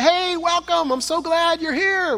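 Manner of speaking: high, bright greeting voice; cheerful welcome. Pretend. Jesus greeting the crowds, high and beaming. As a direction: welcome them like long-awaited friends.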